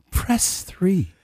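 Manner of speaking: lilting high press three; phone menu voice. Shown as a demonstration. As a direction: repeat the option like a phone recording. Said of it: "I did some voice, uh, prompts for work".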